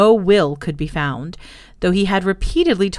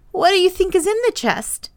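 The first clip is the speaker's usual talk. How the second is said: put-on high, nervous child's question; shaky voice; 12 semitones higher, 3 dB louder than usual. A narrator's reading. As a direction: ask it anxiously, pitched high. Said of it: "in rather a shaky voice".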